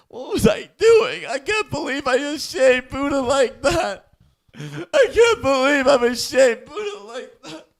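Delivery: high, slow, anguished wail; mock anguish. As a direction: ham up a tearful breakdown, rising to a cry. Host breaks down over shaming Buddha.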